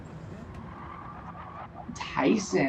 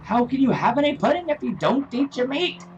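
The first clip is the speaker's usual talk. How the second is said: a hint of the shouting schoolmaster, quick; lightly voiced strict teacher scolding a pupil; [name] teacher quote; 6 semitones higher, faster than usual. Streamer quoting the pudding teacher, lightly mock-stern. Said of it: "[name] joke".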